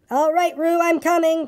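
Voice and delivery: high reassuring call. A narrator's reading. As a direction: call ahead on a high, sustained pitch. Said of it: "called Christopher Robin".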